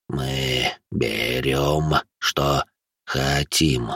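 low, hushed creature voice with long drawn words; laboured ghoul speech. A narrator's reading. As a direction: push out each word separately and slowly. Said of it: "людская речь говорящему даётся с немалым трудом". (низкий голос гуля, слова с трудом, протяжно)

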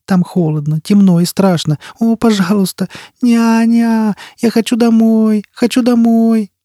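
put-on high, drawn-out child's wail; thin pleading voice climbing very high. A narrator's reading. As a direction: beg like a frightened small child. Terrified child begs for nanny.

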